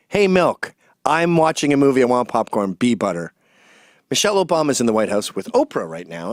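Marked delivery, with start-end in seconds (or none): none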